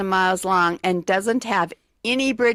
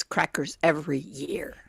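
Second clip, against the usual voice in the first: whisper; 5 semitones lower, 6 dB quieter than usual. Acted. Whispering the fact's last words.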